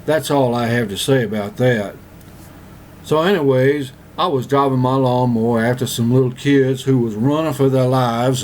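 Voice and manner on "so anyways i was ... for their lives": normal talking voice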